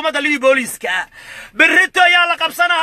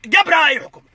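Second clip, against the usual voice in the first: ordinary talk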